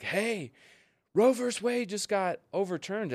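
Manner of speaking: put-on shouted high in a New York accent. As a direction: call out to her mom, New York accent. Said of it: "in this, like, New York accent".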